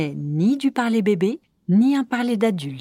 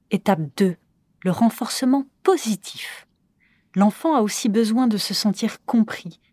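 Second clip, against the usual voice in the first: normal talking voice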